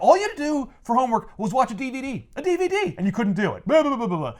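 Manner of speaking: high, laughing teacher rant; mock ranting teacher. Annoyed teacher scolding students about homework, hammed-up high whine.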